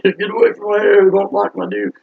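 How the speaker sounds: loud squealed mock protest; high-pitched protest. Acted hair panic, repeated.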